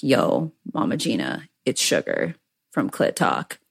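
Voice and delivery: soft, low call to her idol; hushed greeting. Retold or imitated. Fan softly greeting her idol after the workshop. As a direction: lean in and greet her quietly.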